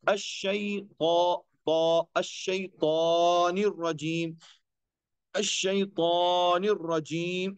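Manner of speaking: अश्शैतानिर रजीम slow, with a heavy तौ; recitation demo. Shown as a demonstration. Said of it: "इस तरीके से हम अदा करते हैं". (अश्शैतानिर रजीम तिलावत)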